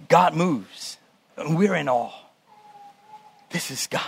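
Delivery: soft, low reverent hush; low and reverent, fading almost to nothing; hushed awe. Real, not acted. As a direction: drop away softly in awe of God. Preacher dwells on awe at God.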